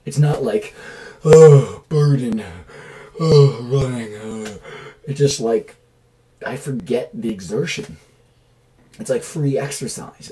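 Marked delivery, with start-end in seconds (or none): 1.2-4.6 loud, exaggerated sigh of burden; mock groan. To the camera, mocking exercise as a burden, hammed-up groan. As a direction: ham up the weariness of running.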